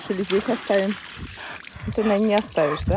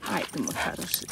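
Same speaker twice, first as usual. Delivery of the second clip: tender whisper; 5 semitones lower, 11 dB quieter than usual. Real, not acted. Cooing to dog, whispered.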